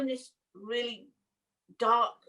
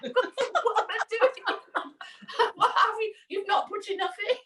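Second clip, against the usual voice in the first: high, laughing retold protest; 9 semitones higher than usual. Arguing with the nurse about the dose, laughing. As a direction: laugh through the objection.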